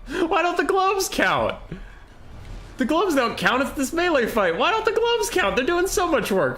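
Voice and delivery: very high, squealing comic rant; mock outrage. Streamer railing at the game for his faction, high-pitched mock outrage. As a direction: whine in protest way up high.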